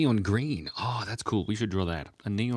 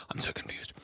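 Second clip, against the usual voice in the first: whisper; 7 dB quieter than usual. Real, not acted. Hushed confession on stream.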